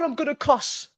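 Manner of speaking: high, exasperated outburst; exasperated warning. Real, not acted. Fan robbed of a goal, exasperated. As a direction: blurt it at a high pitch. Outraged at offside call.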